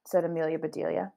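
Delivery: ordinary talk, nothing marked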